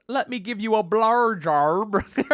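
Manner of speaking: high, slow, badly faked Australian accent; hammed-up Australian impression. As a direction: mangle it in mock Australian, drawing out the vowels. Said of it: "I think Australian accents are the best".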